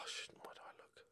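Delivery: unvoiced whispered aside. Real, not acted. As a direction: breathe it under your breath. Muttering to himself while playing.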